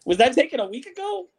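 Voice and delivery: high-pitched question through laughter; laughing. Real, not acted. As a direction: ask it while cracking up, pitch jumping. Laughing at the live street video.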